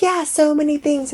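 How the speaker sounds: high, airy mock agreement; high breezy mock of Megan. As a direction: echo vaguely, sing-song. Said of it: "She's like".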